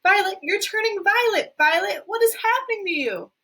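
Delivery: hysterical. Acted. Mother in panic.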